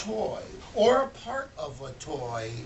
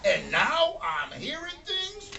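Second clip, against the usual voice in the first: high, strained complaining voice; frustrated Mr Smith; 11 semitones higher, 4 dB louder than usual. Acted. Toymaker whining to himself about his bad luck, high and strained. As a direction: whine like a broke, sleepless toymaker.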